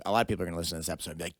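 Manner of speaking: ordinary delivery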